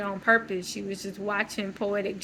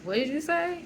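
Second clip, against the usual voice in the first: high, rising surprised question; imitating someone caught off guard; 7 semitones higher than usual. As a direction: ask it taken aback, pitch climbing. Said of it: "surprise, like".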